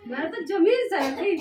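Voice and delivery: loud, slow shocked telling; stunned. Real, not acted. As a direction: let the shock hit the room. Wife shocked at husband's face.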